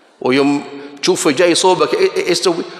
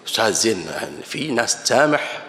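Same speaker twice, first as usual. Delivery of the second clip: meek pleading; 6 semitones lower, 5 dB quieter than usual. Retold or imitated. Student begging the teacher not to hit, meek and pleading. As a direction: plead quietly for forgiveness.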